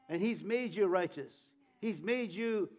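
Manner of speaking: normal talking voice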